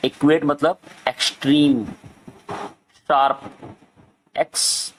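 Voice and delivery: ordinary delivery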